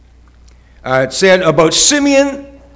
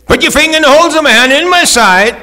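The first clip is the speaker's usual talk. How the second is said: higher, louder, rushed invitation; urgent Jesus; 9 semitones higher, 4 dB louder, faster than usual. Acted. Jesus urging Thomas to touch, fast and raised. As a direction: hurry Thomas to touch the wounds.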